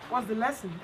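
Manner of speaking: ordinary delivery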